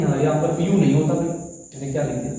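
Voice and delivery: ordinary talk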